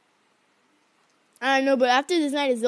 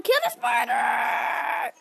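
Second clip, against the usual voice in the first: panicked yell; 4 semitones lower, much slower than usual. Real, not acted. Panicked gamer yelling at spider.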